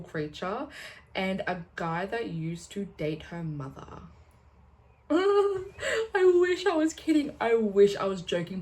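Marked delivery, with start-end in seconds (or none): 5.1-8.6 high, loud drawn-out wail, half laughing; laughing dismay. Real, not acted. Reader confessing an absurd love triangle, laughing dismay. Groaning over absurd plot she recounts.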